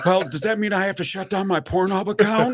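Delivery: high-pitched, playing dumb for a scam caller; feigned concern. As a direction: act the worried, clueless victim. Playing dumb to string scammer along.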